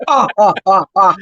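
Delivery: counting laugh like the Count; the Count's laugh. Retold or imitated. Imitating the Count's laugh about the priest. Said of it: "like the count".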